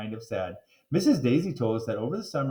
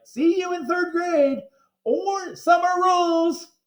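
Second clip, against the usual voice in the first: high put-on kid voice, slowed; sing-song the yearbook notes; 7 dB louder, slower than usual. Narrator voicing his yearbook notes, high and slow.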